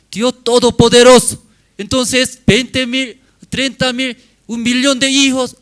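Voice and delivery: put-on preacher marveling at a million children; excited building. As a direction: swell with wonder at the numbers. Preacher builds to God's promise.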